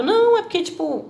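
high, earnest mock Jesus voice; voicing Jesus. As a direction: play Jesus, lofty and sure. Voicing Jesus, much higher.

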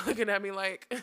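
ordinary talk

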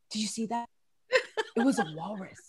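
low, hushed conspiratorial whisper of a husband; hushed mock husband. Husband hushed about his walrus fart. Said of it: "he'll be like".

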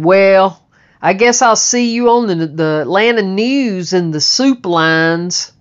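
mock him like a scornful dad; mean mocking father. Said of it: "He was so mean".

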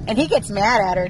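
ordinary delivery

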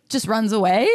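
ordinary talk, nothing marked

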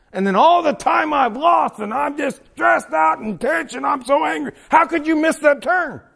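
loud, high, hammed-up frustrated shout; mock road rage. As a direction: act out fuming at yourself in the car.

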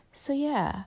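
soft, high, tiny tail-off; meek, softened wrap-up; ending softly. Retold or imitated. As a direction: let it shrink to a small voice. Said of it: "then I kinda end softly".

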